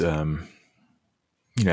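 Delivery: ordinary talk, nothing marked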